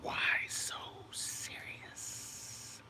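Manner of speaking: slow breathy whisper of the movie villain; whispered Joker line. Retold or imitated. Streamer answers a too-serious chat comment with a slow villain whisper.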